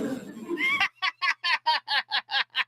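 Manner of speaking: high, disbelieving burst of laughter; incredulous laughter. Real, not acted. Vlogger hearing Biden's clip, laughing in disbelief. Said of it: "How is he saying that with a straight face?".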